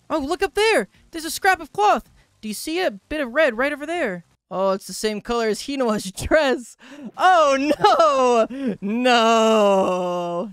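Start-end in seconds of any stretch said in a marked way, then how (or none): 0.1-4.3 high, loud, urgent pointing-out; raised, eager character voice; alarmed villager. Acted. Voices villager spotting cloth. 7.2-10.5 loud, drawn-out cry of no; theatrical anguished moan; dismayed wail. Acted. Mock despair at bad discovery.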